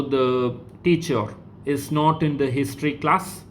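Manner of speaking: "the teacher is not in the history class" read as a question, rising on "class"; dictated question with a rising end on "class"; question intonation. Shown as a demonstration. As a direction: lift the pitch on "class" to ask it.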